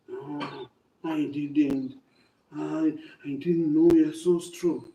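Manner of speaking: low worn-out elephant; elephant's voice. Acted. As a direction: drop the voice low like a big tired animal.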